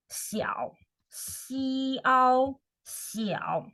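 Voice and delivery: 小 dipping down then up; 小 as a slow dipping third tone. Shown as a demonstration. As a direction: model 小 falling first, then rising. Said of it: "Third tone".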